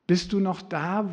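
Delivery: ordinary delivery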